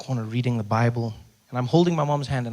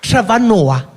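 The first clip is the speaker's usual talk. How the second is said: higher and louder, half-recognizing; clerk voice; 6 semitones higher, 10 dB louder, much slower than usual. Retold or imitated. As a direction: say it as if it rings a bell.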